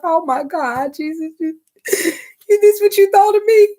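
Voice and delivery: high teary disbelief at being loved; high, giggling, awed voice; overwhelmed gasp. Retold or imitated. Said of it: "you be like".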